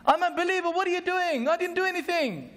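high-pitched hammed-up protest of innocence; mock scream of protest. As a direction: act the beaten man crying out in disbelief. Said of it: "And he screams".

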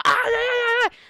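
high, long string of yelps; cartoonish howl cutting off a boast; mock pain wail. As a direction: squeal in exaggerated pain. Cactus hurts him mid-threat.

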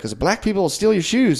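high lightly voiced Irish lady; imitating the Irish lady. Irish lady warning him about shoes, high mock voice. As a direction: say it in her high earnest voice. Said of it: "she's like".

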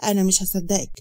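usual speaking voice

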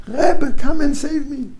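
very high, loud cry to the Rebbe; mock scream for help. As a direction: call out desperately, hammed-up. Chassid begs his Rebbe.

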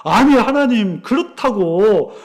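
imitated prophet's indignant challenge; arguing with God. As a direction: act out righteous anger, taking God to task. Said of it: "또 막 따지는 거예요".

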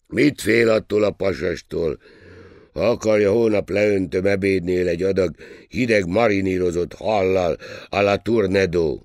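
loud, high-pushed bravado; waiter's swaggering dare; rough-and-ready waiter, boasting. A narrator's reading. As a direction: offer it loudly and brashly. Waiter's figure voice.

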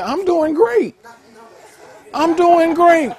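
very high, loud proclamation; triumphant, full-voiced shout; exuberant declaration. Shown as a demonstration. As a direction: belt it out high over the crowd. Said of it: "you don't have to have an attitude".

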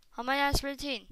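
normal talking voice

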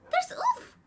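high, sharp startled gasp. Real, not acted. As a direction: gasp then blurt it out. Startled by wolf.

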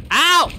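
sharp, very high yelp of pain. Acted. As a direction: shriek as the gator bites. Bitten by gator again.

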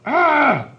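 high, loud scream of terror; sudden full-voiced shriek; frightened yell. Big man seeing the scary face, frightened yell. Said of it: "The man yelled".

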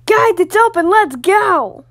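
high, loud call to the group. Acted. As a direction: shout so everyone hears the door is open. Calling the group forward.

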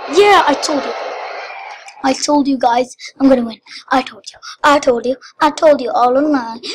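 rapid-fire, loud I-told-you-so; loud gloating. Real, not acted. Winner telling viewers they were told, gloating. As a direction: crow loudly over the win. Gloats after winning the game.

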